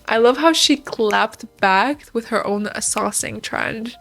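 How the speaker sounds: ordinary talk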